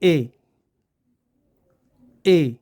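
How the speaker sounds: short lax "ए" demonstrated; short vowel demo. Shown as a demonstration. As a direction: say "ए" short, the second vowel of the diphthong. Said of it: "कैसे pronounce करते हैं?".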